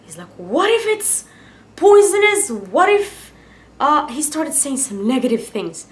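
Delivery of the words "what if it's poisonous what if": high-pitched worried friend; raised, mock-panicky questioning; imitated panic. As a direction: act out a friend fretting over the worst. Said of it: "it made me panic".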